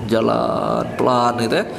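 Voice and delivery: low, dragging mock-humble voice; feigned humility. Preacher mimicking a slow show-off walk, mock humility. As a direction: stretch it like an affected feeble walk. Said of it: "dia bikin-bikin jalannya".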